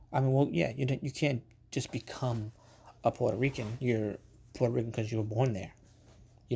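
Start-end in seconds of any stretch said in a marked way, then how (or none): none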